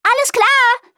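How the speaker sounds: very high call from up the tree. A narrator's reading. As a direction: call down from the branch. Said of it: "rief sie".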